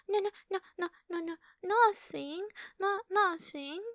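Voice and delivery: high, rushed mock denial; flustered denial. Creature mocking the human who denies a dirty thought, high. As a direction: play the blushing human brushing it off.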